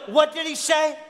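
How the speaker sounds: shouting over the ballgame noise. Retold or imitated. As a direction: yell it like he's startled. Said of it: "He goes".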